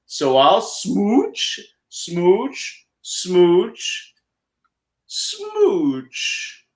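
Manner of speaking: smoochy fish. Acted. The kissed fish spreading cheer, slow high smooches. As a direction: stretch each smooch sweetly upward. The happy fish ends the book.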